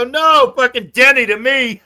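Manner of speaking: high, loud, amused protest; retold exasperated correction. Correcting the housemates about Denny, retold as loud protest. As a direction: shout the correction, half laughing.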